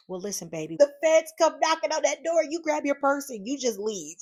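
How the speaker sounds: mock-serious advice, up high; hammed-up motherly counsel; voicing mom's advice. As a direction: playfully imitate her mama's warning. Mother and sister's advice voiced.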